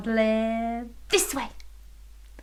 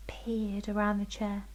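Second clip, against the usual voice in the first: whispering; 10 dB quieter than usual. Shown as a demonstration. Demonstrated peering, whispered.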